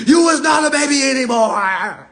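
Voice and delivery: hammed-up mom telling her son he's no baby; Jody's mama. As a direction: play the mama kicking her grown son out. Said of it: "what Jody mama had to tell him".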